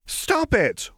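high protesting cry; embarrassed cry. A narrator's reading. Lux protests Jinx's laughter, high cry. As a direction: beg her to stop, mortified. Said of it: "Lux cried".

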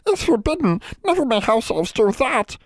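high-pitched, alarmed elf voice; high, frightened little creature; horrified house elf. A narrator's reading. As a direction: squeal the rule in horror. Elf refuses in horror.

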